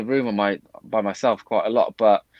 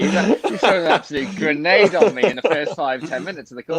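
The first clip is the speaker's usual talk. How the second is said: laughing; 6 semitones higher, 4 dB louder than usual. Real, not acted. Guest laughing at the grenade question.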